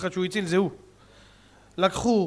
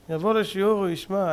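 ordinary talk

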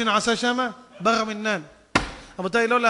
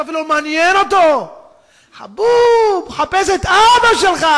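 acted anguish, preached at full force; wailing lament; 7 semitones higher, 11 dB louder than usual. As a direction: plead at full voice with long wails. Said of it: "עמדתי ובכיתי".

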